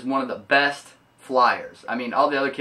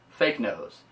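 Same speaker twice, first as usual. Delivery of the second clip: ordinary talk, nothing marked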